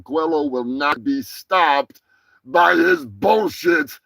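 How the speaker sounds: slow, drawn-out solemn threat; slow dramatic vow. Acted. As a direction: spell out each word like a warning. Dramatic vow against rival.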